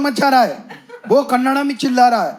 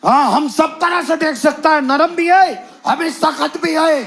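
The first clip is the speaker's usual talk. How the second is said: higher boastful broken Hindi; mock driver boast; 7 semitones higher than usual. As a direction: puff up like the driver.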